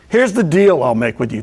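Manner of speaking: normal talking voice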